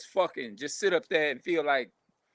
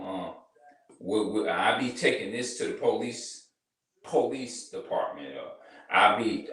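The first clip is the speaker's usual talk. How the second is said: rude threatening dater; 7 semitones lower than usual. Retold or imitated. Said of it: "and some of them be like".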